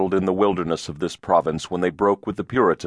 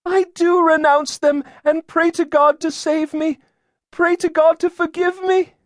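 put-on very high, loud girl's voice; distraught girl; 5 dB louder than usual. A narrator's reading. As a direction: plead as a frightened young girl. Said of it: "Tears spilled down her cheeks".

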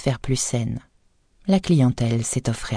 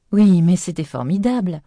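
higher, louder delighted praise; wife to husband, excited praise after the movies; enthusiastic; 5 semitones higher, 4 dB louder than usual. Acted. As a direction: gush about the films. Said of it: "les yeux brillants".

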